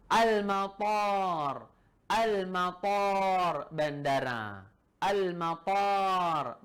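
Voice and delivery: Al-matar drawn out slowly, each syllable clear; slow model reading. Shown as a demonstration. Teacher reads Al-matar slowly for students to repeat. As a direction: say Al-matar slowly for the class to copy. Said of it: "Ikuti seperti adanya di kitab ini".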